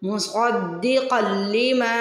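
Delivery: मुसद्दिक़ल लिमा with ma held long; two-count stretch. Shown as a demonstration. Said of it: "टू second के लिए लंबा करके".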